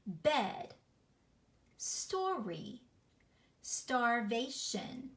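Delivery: slow, separated syllables; beat by beat. Shown as a demonstration. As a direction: let each syllable's beat be heard. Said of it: "You hear those beats. Listen to these words".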